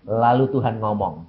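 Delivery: ordinary talk, nothing marked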